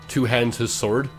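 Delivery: ordinary talk, nothing marked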